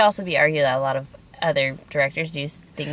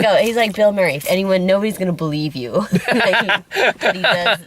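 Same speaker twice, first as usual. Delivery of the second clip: loud laughing talk; 5 semitones higher, 6 dB louder than usual. Real, not acted. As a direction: laugh through the words. Laughing excited about Bill Murray.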